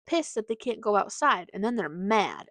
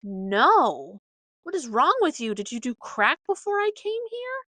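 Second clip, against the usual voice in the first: shocked puppy; 4 semitones higher, 6 dB louder than usual. Retold or imitated. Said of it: "And Jackson's like".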